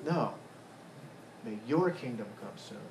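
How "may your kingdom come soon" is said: YOUR weighted in a softer, lower line; stress on YOUR. Shown as a demonstration. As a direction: quietly lean on "your". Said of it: "See the contrast there?".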